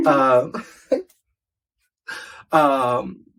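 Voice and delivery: giggling through filler words; laughing. Real, not acted. Laughing mid-story.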